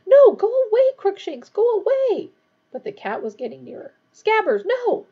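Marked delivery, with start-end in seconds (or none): 0.0-2.3 very high, loud pleading; frantic moan. A narrator's reading. Hermione tries to drive off her cat, loudly pleading. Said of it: "Hermione moaned". 4.1-5.1 high, loud shout of protest; alarmed cry. A narrator's reading. Ron sees his rat escaping, crying out in alarm.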